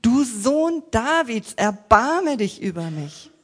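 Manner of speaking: a loud, slow plea for mercy; crying out. A narrator's reading. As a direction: call out loudly and slowly, begging. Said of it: "Er aber rief noch viel mehr".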